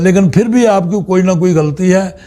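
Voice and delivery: usual speaking voice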